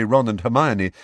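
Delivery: plain reading voice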